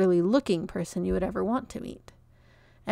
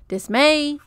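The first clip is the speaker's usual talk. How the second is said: higher and louder call; calling the dog; 7 semitones higher, 8 dB louder than usual. A narrator's reading. Mister Johnson calling his dog back, loud call. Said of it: "he called".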